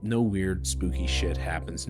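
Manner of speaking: ordinary talk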